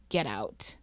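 low, soft command; dropped pitch, little force; understated order. Retold or imitated. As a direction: quietly voice a soldier's curt order. Soldier voiced ordering her out.

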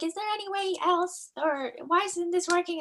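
high mock voice of a confused guard; puzzled guard voice. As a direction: play the baffled ticket man. Voicing the ticket guard.

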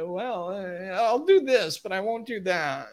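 high whiny mock reluctance; wishy-washy hedging. Voices own hedging excuses.

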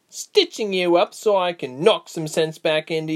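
ordinary delivery

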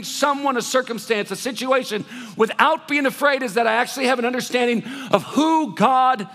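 loud, high, impassioned preaching; full-force preaching. Acted. Pastor preaching hard to the congregation, loud and high. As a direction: drive every line home from the pulpit.

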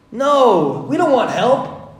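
loud, high, fast boast; mock boast of doing it all alone; proud self-reliance. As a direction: brag that you can do it alone. Mankind voiced, boastful.

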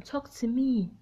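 ordinary talk

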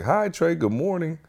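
high, soft greeting; cafeteria lady. Retold or imitated. Cafeteria lady greeting the boy as he passes, high imitation. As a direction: imitate a lady's singsong good morning. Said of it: "she was like".

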